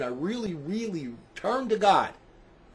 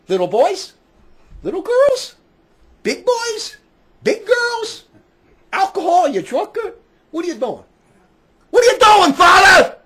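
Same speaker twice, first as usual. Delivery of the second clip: high, loud questions building to a shout; accusing questions, rising to a full yell; angry interrogation; 12 semitones higher, 10 dB louder than usual. Acted. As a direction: grill him item by item, then explode. Grilling a priest about his sins.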